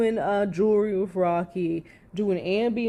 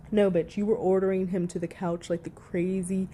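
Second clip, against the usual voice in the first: normal talking voice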